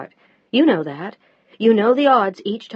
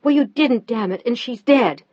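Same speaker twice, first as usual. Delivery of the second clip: higher, louder shout; 5 semitones higher, 6 dB louder than usual. A narrator's reading. Wife shouting back at her grieving husband. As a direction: hurl it back at him. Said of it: "Now Mel was shouting, too".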